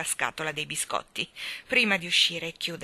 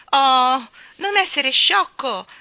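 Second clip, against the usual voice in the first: high, loud, drawn-out cry; exasperated little-girl yell; shouted rebuke; 8 semitones higher, 14 dB louder, much slower than usual. Acted. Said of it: "gridò Annabella".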